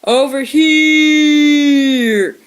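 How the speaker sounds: long drawn-out loud high call; odd shout. A narrator's reading. Shrew calling out again, long odd shout. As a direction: stretch the shout out oddly. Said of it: "an odd shout".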